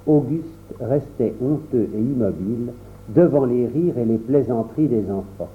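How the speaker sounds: plain reading voice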